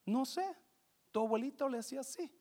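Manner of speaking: a quiet, offhand answer as the mother. Acted. Mother answering her daughter's question, lightly voiced mother. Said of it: "La mamá dice".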